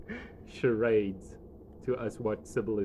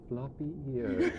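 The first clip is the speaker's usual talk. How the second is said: puzzled; slower than usual. Retold or imitated. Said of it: "Craig, and you're like".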